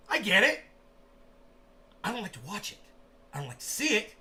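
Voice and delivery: ordinary talk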